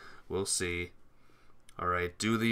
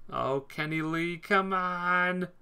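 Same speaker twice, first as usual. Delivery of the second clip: cheering; 8 semitones higher, slower than usual. Real, not acted. Cheering a teammate on.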